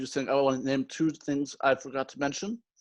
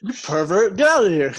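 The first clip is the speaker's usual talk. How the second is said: loud, raised-pitch mock scolding; mock outrage; 4 semitones higher, 7 dB louder than usual. Co-host teasing over hentai, mock outrage. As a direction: throw your friend out in pretend disgust. Joking outrage at co-host's anime remark.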